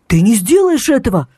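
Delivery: mild mock outcry; hasty shout. A narrator's reading. Danil panicking on the phone, mild shout. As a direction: blurt it out in a panic. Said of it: "поспешно выкрикнул Данил".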